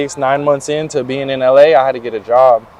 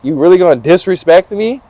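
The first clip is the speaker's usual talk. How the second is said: indignant, louder and higher at first; retold indignation; 5 semitones higher, 4 dB louder than usual. Retelling talking back to the disrespectful French manager, indignant. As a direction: let the outrage burst out, then settle. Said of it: "I was like".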